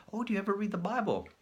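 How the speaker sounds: high, fast, prim visitor voice; high polite Jehovah's Witness. Retold or imitated. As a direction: put on the sweet door-knocker.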